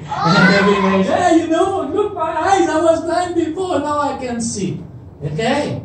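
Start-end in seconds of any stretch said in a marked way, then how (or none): 1.1-4.8 high-pitched, eager boasting; excited healed man. Retold or imitated. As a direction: spread amazing news to everyone. Acts healed man telling everybody.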